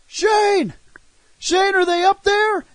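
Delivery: high call up to the roof; a hint of shouting upward. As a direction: call up to a friend out of sight. Calling up to the roof.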